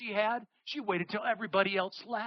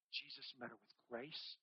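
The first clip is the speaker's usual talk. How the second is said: ordinary delivery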